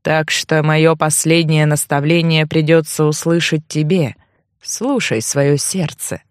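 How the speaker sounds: put-on low old man, slowing to the end; deep-pitched, subdued elder's farewell; ghostly old man. A narrator's reading. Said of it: "Призрачная фигура закряхтела".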